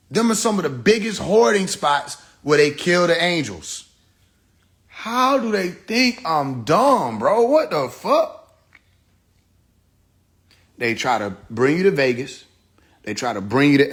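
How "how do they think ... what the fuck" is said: incredulous outburst. Real, not acted.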